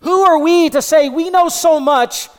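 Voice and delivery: high, loud, emphatic sermon delivery; full-force preaching. Real, not acted. Preacher challenging congregation's pride before God, forceful preaching. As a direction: drive it home with rising force.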